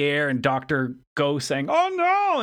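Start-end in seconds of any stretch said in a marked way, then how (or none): 1.7-2.4 very high, drawn-out "no" in mock horror; mock alarm. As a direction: imitate a slow-motion cry of dismay. Retold cry as object flies.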